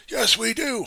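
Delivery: high, shouted mock complaint; mock protest. Election denier insisting to a doubter, high mock shouting. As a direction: yell it like a stubborn objector. Acted quarrel, denier's side.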